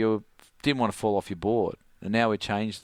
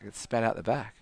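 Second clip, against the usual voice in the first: ordinary talk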